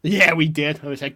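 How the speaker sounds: ordinary delivery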